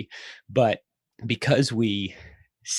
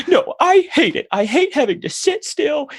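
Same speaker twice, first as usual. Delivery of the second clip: put-on very high, loud kid wail; hammed-up high child whine; protesting four-year-old; 6 dB louder than usual. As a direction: mimic a small child digging in and complaining. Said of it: "she just goes".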